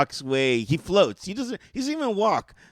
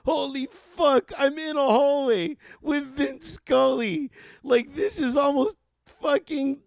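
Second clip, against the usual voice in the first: higher and slower, marveling; starstruck awe; 7 semitones higher than usual. Retold or imitated. Fan recalling a hallway meeting with Vin Scully, awestruck. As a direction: savor every word in disbelief.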